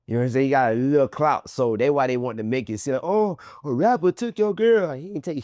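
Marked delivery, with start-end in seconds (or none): 3.0-4.9 high, slow sneering quote; mock the internet gossip. Mocking the internet's take.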